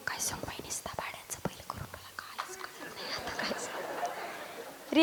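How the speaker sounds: hushed confiding complaint; barely voiced, secretive grumble; gossiping whisper. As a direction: whisper your grievance to a friend. Mother-in-law complains secretly in reply.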